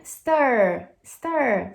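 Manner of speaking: "stir" loud and stretched, voiced to the end; "stir" falling slowly on the R. Shown as a demonstration. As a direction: model "stir" with a long English "er". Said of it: "Você tá usando as cordas vocais".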